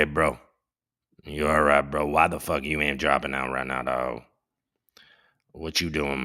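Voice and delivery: a hint of a mumbling old Joe asking his buddy what's up; Joey B impression. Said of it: "I'll stop doing that voice".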